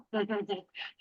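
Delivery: soft giggle; little giggle. Shown as a demonstration. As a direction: let a quiet little laugh slip out. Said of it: "get a little giggle".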